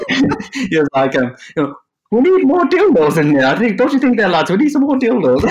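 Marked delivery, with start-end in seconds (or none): 2.1-5.4 high, loud mock Irish accent, half Jamaican; loud hammed-up Irish client voice. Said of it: "in Irish accent".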